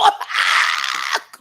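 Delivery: high, breathy shriek of laughter. Real, not acted. Watching the show, a high shrieking laugh. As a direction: burst into a screaming laugh.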